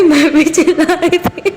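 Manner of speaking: words spilling through giggles; real laughter breaking the words; laughing. Real, not acted. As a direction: tell it while still laughing. Laughing while retelling the scare.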